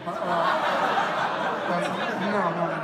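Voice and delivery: laughing loudly while protesting. Real, not acted. Laughing denial to audience.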